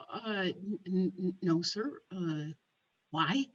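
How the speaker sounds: low, soft, halting reply; small, uncertain acted answer; sheepish hesitation. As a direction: stammer quietly as if caught out. Caught-out telemarketer answers hesitantly.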